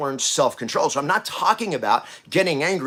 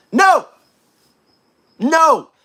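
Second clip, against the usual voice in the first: emphatic shout; 13 semitones higher, 10 dB louder than usual. Real, not acted. Rejects calm suppression.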